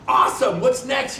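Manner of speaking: spouse's bright, loud "what's next"; mock excited spouse. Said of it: "your spouse looks at you and goes".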